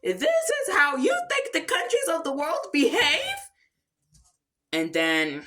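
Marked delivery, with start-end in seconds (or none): 0.0-3.5 high, put-on scolding; high-pitched mock-stern outburst; Madame Gazelle fed up. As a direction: scold like a teacher who has had enough. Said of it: "Madame Gazelle had enough".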